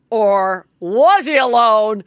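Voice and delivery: slow, loud cliffhanger voice; dramatic announcer tease. Acted. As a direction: tease it like a TV host.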